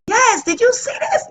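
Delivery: high-pitched gleeful squeal; excited retold reply. Wife showing her husband a creative idea, excited. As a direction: burst out thrilled, voice leaping way up.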